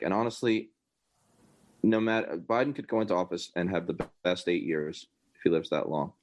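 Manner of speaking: ordinary delivery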